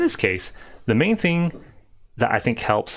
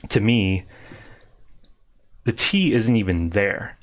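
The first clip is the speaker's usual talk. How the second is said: usual speaking voice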